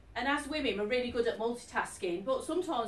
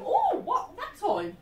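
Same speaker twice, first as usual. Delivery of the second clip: high, loud startled gasp of a question; startled waking; 9 semitones higher, 6 dB louder, much slower than usual. Acted. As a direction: act out waking to a shock at the clock. Acted waking at odd hour.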